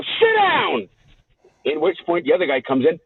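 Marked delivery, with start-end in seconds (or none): 0.0-0.9 high-pitched, drawn-out angry order, retold; retold raised voice. Customer orders the banker back into his seat, raised voice. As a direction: re-enact raising your voice at the banker. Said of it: "no need to raise your voice".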